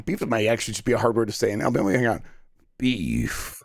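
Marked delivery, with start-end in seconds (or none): 2.8-3.6 low gravel growl, crisp final F; gravelly voice. Shown as a demonstration. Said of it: "really get that F out there".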